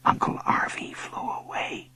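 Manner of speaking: soft, sorrowful whisper. A narrator's reading. Boy confiding in his dog, whispering. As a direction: whisper it into a listening ear. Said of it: "I whispered in Bo's ear".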